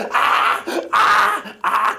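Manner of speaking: put-on sharp high cries of a wailing child; imitated child crying. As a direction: mimic a child's wail. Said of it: "막 우는 거예요".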